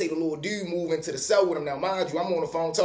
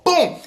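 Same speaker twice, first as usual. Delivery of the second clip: mock boom sound; 12 semitones higher, 6 dB louder than usual. Sound of the hit on his foot.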